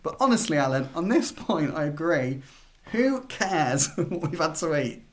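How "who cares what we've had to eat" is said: slow, laughing through the question; chuckling, a little guilty. Real, not acted. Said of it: "I'm laughing 'cause I feel bad saying it".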